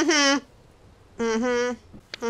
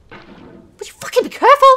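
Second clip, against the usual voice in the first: ordinary talk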